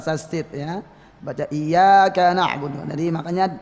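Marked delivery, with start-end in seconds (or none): 1.5-2.8 "iyyaka na'budu" with a louder, doubled y; raised tasydid. Shown as a demonstration. Teacher showing the verse read with tasydid, voice raised. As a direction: lean on the double y in "iyyaka", lifting the voice. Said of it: "untuk mempertegas suara diangkat".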